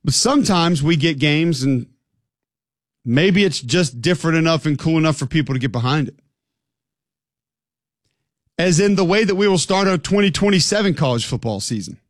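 ordinary talk

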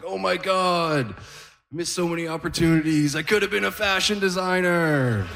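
hammed-up wonder and regret; overwhelmed old man. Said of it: "He's like".

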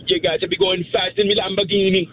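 high-pitched Jamaican accent. Shown as a demonstration. Caller performing on the hosts' request, in a Jamaican accent. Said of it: "No, a Jamaican accent".

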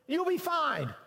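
ordinary talk, nothing marked

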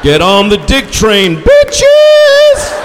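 builds to a huge high yelled "bitches"; rally the troops, screaming the last word; rallying shout. Acted. Comic rallying the room, ending in a screaming yell. Crowd rallying call in bit.

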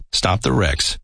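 usual speaking voice

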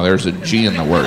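ordinary talk

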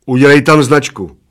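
bossy giant's instruction; commanding. A narrator's reading. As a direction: order it done. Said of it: "poručil Plešatec".

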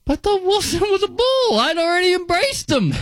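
high hammed-up whimper; mock heartbreak. Fan mourning a player he already embraced, mock whimper. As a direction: pretend your heart just broke.

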